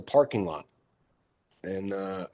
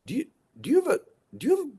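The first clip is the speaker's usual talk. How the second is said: asking in disbelief, pitched up high; surprised question; faster than usual. Retold or imitated. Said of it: "they're like".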